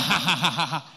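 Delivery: imitated mocking ha-ha-ha; mock laugh. Interpreter retelling the voice heard behind the man, mock laugh. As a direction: recount a voice laughing, each ha spelled out. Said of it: "somebody was laughing at the back".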